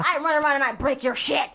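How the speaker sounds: put-on little terror voice; rampaging toddler. Co-host acts out the next childhood phase, mock rampaging toddler. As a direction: play a destructive toddler, hammed up. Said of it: "we got to the".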